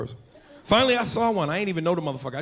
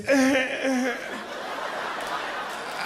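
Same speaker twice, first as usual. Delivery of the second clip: wheezing laugh; 8 semitones higher, 3 dB quieter than usual. Real, not acted. Laughing at own bit.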